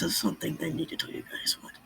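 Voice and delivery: hushed secret whisper. Acted. Telling friends a secret, whispered. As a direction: lean in and whisper it.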